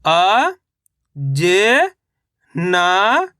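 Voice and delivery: each syllable held long, separated by pauses. Shown as a demonstration. Teacher sounding out a word for reading learners, syllable by syllable. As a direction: sound out each letter slowly, stretching it. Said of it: "एक एक अक्षर का उच्चारण".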